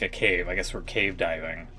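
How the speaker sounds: plain reading voice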